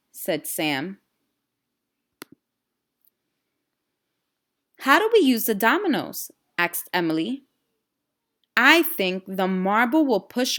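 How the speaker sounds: lively storybook reading with voiced characters; reading to children. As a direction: read the story aloud to a class of kids, animated. Teacher reads storybook to class.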